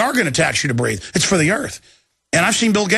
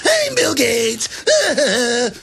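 much higher, slow elf parody; squeaky demonic elf bit; 7 semitones higher, much slower than usual. Retold or imitated. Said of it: "like a demonic elf".

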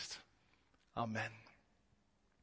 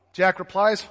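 normal talking voice

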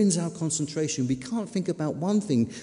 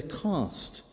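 ordinary talk

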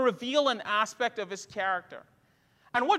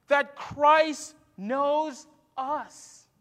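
slow, drawn-out emphasis; 2 semitones higher, much slower than usual. Acted. Preacher slows for key truth.

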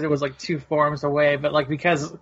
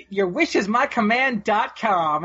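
mock cheesy line; 4 semitones higher than usual. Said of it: "He goes".

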